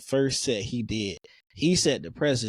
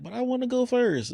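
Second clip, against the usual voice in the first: mock pleading, much higher pitch; high whiny voice; 13 semitones higher than usual. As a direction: whine it up high like a kid. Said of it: "I was like".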